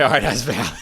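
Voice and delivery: loud laughing resignation. Retold or imitated. Retelling the Uber driver's retort, laughing loudly. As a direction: laugh it out, giving up.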